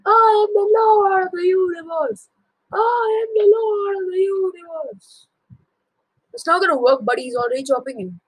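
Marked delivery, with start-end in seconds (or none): 0.1-2.3 high crying boast; cling to pride through the pain; wailing boast. Acted. Wounded father taunting sons, high wailing. 2.7-5.3 high, crying shouted boast. Acted. Wounded father shouting at sons, crying out. Repeated louder.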